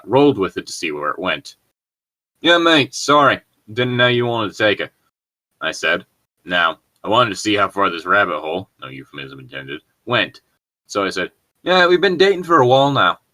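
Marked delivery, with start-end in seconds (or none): 2.4-4.9 put-on a light Aussie accent, British slipping; drifting British into Aussie. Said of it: "British to Aussie?". 11.6-13.2 put-on a light Aussie accent, louder and higher; cheeky Aussie accent. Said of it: "British to Aussie?".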